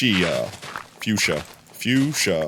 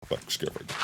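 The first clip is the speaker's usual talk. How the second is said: whisper; 15 dB quieter than usual. Real, not acted. Whispered exclamation.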